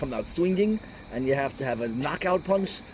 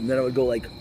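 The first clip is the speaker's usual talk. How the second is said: normal talking voice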